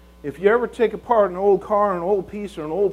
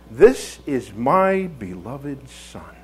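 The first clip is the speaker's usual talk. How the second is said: very slow, lower and measured; solemn tender declaration; 4 semitones lower, much slower than usual. Acted. God presents His Son to the world, slow and tender. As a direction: let each word settle gently.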